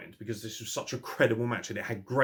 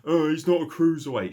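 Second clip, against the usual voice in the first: mocking fan quote; 7 semitones higher, 8 dB louder than usual. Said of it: "talking about".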